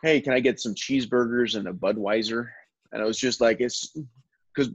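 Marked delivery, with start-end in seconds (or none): 0.0-2.5 Australian trying an American accent, hard R's; put-on American frat boy accent. Shown as a demonstration. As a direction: stretch the words and pronounce every R in American. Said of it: "trying to get me to sound American".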